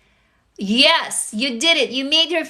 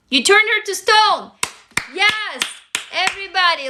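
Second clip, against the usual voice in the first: excited; 7 semitones higher than usual. Real, not acted. Reacting to a viewer's comment.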